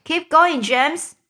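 high, loud cheer; crying out. A narrator's reading. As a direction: urge him on loudly. Cheering James on.

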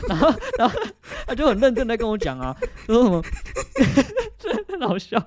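high, laughing through every word; trying to talk through the giggles; laughing while talking. Real, not acted. Retelling a funny call, cracking up.

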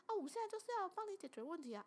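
high, fast mock mainland Chinese accent. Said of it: "对方的声音听起来就是一个中国腔". (模仿大陆腔，语速快、音调高)

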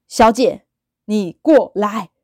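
slow, eerie summons in a changed voice; slow creepy auntie. Retold or imitated. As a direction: call her over like an unsettling stranger.

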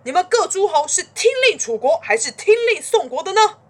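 high, forceful shouted challenge; loud shout. A narrator's reading. Chu's men demanding the lords choose sides, shouting. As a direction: call it out across the whole assembly. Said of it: "这时楚成王部下大喊".

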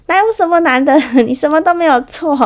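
high, loud exasperated outburst; exploding inner voice. Retold or imitated. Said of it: "就大爆炸". (心里的OS大爆炸，拔高嗓门)

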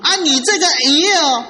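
puzzled mother-in-law. Acted. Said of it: "我这个岳母啊，是越看这个鱼，她越纳闷儿".

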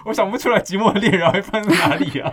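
high laughing tease. Real, not acted. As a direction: ask through a giggle. Laughing teasing question.

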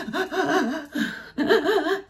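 high, breathy mock gasp-laugh; imitated gasping sound. Showing the listener the wife's sleep noise, a high mock gasp. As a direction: act out the strange breathing sound. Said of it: "就发出那种声音这样子".

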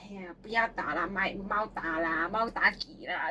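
low, slow pleading granny voice; grandma pleading. Retold or imitated. Grandma begging mum not to hit the child, low slow plea. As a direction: beg gently and slowly like an old granny. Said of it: "婆婆就会".

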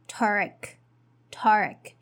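"Turk" with a weak er vowel, like in mother; unstressed er syllable. Shown as a demonstration. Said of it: "It is er here".

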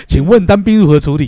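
loud, high mock military drill question; drill examiner. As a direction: put the question like a drill instructor testing a recruit.

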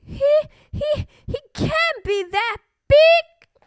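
very high, stuttering bird; stammering. A narrator's reading. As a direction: stumble on the first word in a high voice. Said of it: "stammered the bully bird".

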